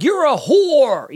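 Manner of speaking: slow, pointed jab like someone over fifty; mock old-timer insult. Host mocking how older folks insult, slowly. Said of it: "what somebody over fifty says".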